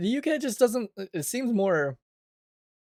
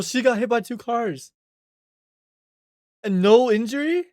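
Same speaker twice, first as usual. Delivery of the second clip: shocked disbelief; 8 semitones higher, 5 dB louder than usual. Real, not acted. Shocked reaction to survival news.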